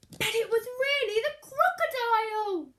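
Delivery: spring the surprise on the listener; dramatic reveal. A narrator's reading. Storyteller revealing the crocodile to children, dramatic and loud.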